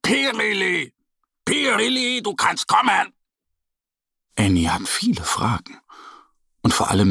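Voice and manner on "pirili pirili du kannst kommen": calling out. Acted. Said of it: "Und noch einmal ganz laut".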